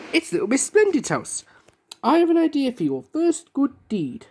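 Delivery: high, eager call; excited cry. Acted. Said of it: "cried Mister Tickle".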